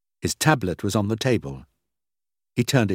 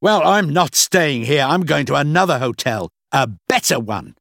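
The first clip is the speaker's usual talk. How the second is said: loud, higher-pitched indignant huff; very angry; 5 semitones higher, 6 dB louder than usual. A narrator's reading. Mervyn storming off to another hotel, very angry. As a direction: announce it loudly and crossly. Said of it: "Mervyn looked very angry".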